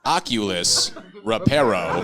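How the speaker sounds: "Oculus, Reparo" said loudly as if it were Spanish, each word set apart; a tourist passing off "Oculus, Reparo" as Spanish, loud and hopeful; fake Spanish. Tourist in Mexico asking strangers to fix his glasses, "Oculus, Reparo" as fake Spanish. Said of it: "in Mexico and I didn't know Spanish".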